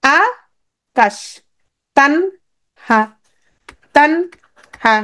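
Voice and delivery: আ, কাশ, তান, হা each alone with long pauses; broken into syllables. Shown as a demonstration. Teacher showing how we break words, syllable by syllable. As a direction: chop the words apart, one syllable per beat: আ - কাশ, তান - হা. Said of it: "আমরা ভেঙে উচ্চারণ করি".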